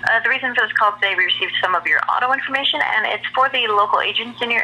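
fast read sales pitch; rushed scripted telemarketer. Real, not acted. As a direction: rattle off the script quickly.

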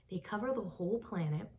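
plain reading voice

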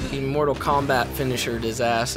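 ordinary talk

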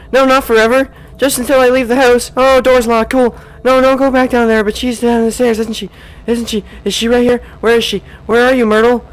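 high tense urgent voice; frantic, loud and high; scared horror game streamer. Real, not acted. Fleeing downstairs in fear.

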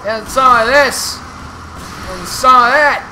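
high, loud gleeful taunts; loud taunting. Real, not acted. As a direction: shout taunts at the boss. Taunting while attacking boss.

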